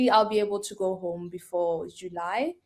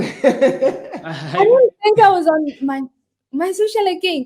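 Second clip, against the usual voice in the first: laughing; 10 semitones higher, 7 dB louder, slower than usual. Real, not acted. Laughing while answering tease.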